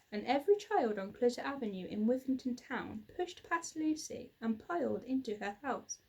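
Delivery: ordinary delivery, nothing marked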